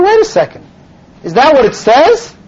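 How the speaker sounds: retold objection, pitched high; incredulous challenge. Rabbi stopping the missionary's explanation, retold incredulous challenge. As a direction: break in and question it sharply.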